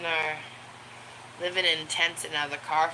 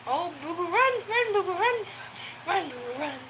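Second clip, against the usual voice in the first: high, delighted cheering for the running dog; excited pet talk; 12 semitones higher than usual. Real, not acted. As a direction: cheer the pup on, high and bright.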